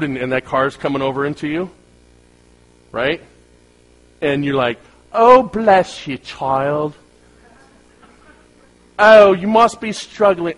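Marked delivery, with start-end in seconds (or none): 5.1-10.5 put-on high, loud, drawn-out British accent; mock British accent. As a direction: coo pityingly in an exaggerated British accent. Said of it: "I don't know why I'm using that accent".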